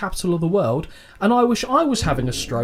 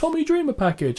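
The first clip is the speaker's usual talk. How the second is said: high mock voice; 12 semitones higher than usual. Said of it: "so I wrote".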